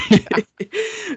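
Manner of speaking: squeaky burst of laughter; high-pitched laugh. Real, not acted. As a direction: let out a high giggle. Host laughs at joke.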